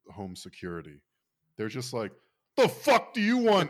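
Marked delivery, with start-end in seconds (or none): 2.6-3.7 put-on loud, high-pitched drunken outburst; drunken angry answer. Customer called at one thirty AM, drunken angry yell. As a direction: yell it like a drunk woken by a phone call. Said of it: "they respond drunkenly".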